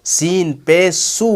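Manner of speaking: slow "सीन पेश सू" with long "सू"; letter-sound demo. Shown as a demonstration. Teacher modelling the letter's sound, slow "सीन पेश सू". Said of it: "उसी की आवाज़ निकालनी है".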